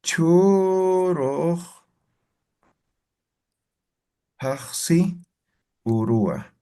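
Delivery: "Hichuroj" stretched out very slowly, then pauses before "paxi" and "urua"; slow dictation. Shown as a demonstration. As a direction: dictate it piece by piece. Teacher dictating the example slowly.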